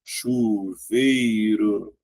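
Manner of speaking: "Chuveiro" very slow, "chu" held together; drawn-out classroom breakdown of "Chuveiro"; syllable by syllable. Shown as a demonstration. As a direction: sound out "Chuveiro" in long syllables. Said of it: "C H, nunca separa".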